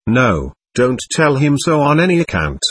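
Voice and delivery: usual speaking voice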